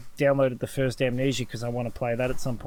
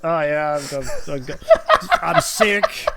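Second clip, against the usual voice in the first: mocking, laughing; 7 semitones higher, 4 dB louder, slower than usual. Mocking friend's highlights.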